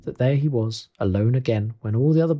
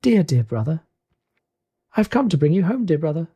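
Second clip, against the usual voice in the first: put-on higher, affectionate child voice; little girl; 5 semitones higher than usual. Little sister arrives to fetch her brother home, joyful. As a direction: greet with loving, excited lift. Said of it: "a little girl".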